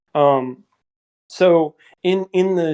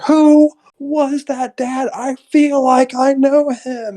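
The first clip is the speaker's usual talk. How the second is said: slow, high, hammed-up actress impression; Sheryl Lee; 12 semitones higher, 3 dB louder, slower than usual. Daughter asking dad about a stranger, high mock acting. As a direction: draw it out high like an overwrought daughter. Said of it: "With Sheryl Lee again. She's like".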